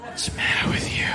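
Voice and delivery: hissed stunned whisper; incredulous whisper. Retold or imitated. Husband stunned by wife's request, incredulous whisper. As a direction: whisper it in disbelief.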